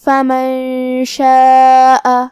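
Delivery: "فمن شاء" very slow, with the nun hidden into a nasal hum before شین; "فمن شاء" with ikhfa ghunna and a long drawn alif. Shown as a demonstration. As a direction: hum the nun of "فمن" through the nose, then glide into "شاء". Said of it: "تو ہمیں یہ حکم کو غنہ کے ساتھ ادا کرنا ہے".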